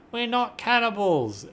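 high, loud desperate cry; cried out. Acted. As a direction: shout it in protest, slowly. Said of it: "One woman cried out".

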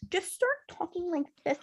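high, soft put-on baby talk. Mocking how girls talk to guys they like, baby voice. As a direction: go small and babyish, mocking it. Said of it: "she's talking like a fucking baby".

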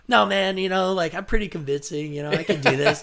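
high, eager boast; mock-cocky coworker pitch; confident brag. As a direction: talk him into it, cocky and bright.

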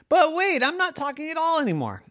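high hurried objection; indignant little girl. A narrator's reading. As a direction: protest like a child who just realized. Yvette realizing, protesting.

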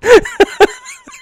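squealing high laugh; cracking up at himself; shrill real laughter; high burst of laughter. Real, not acted. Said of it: "That sounded more Elmer Fudd".

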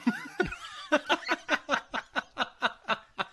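big high-pitched burst of laughter; cracking up high. Real, not acted. Laugh at pronunciation.

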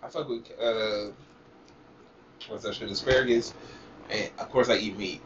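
normal talking voice

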